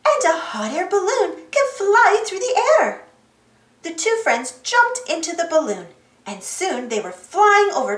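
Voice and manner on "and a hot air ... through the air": high-pitched, eager confirmation; excited. A narrator's reading. Dora confirms the shapes to Boots, excitedly. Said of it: "Dora said excitedly".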